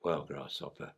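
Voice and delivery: low, soft sage-like counsel; wise old Akita. Acted. Akita Sensei calmly advising the worried puppy, low and soft. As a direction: advise the pup like a calm old master.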